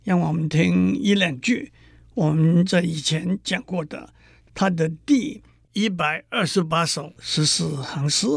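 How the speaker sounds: ordinary talk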